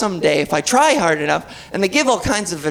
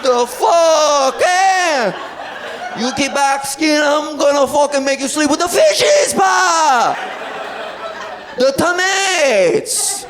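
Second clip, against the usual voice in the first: put-on mobster Italian-American accent; 6 semitones higher, slower than usual. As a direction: threaten Pa like a mob guy, Italian-American accent. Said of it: "putting on an accent".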